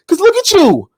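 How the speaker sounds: mock taunt. Taunting, escalating to shout.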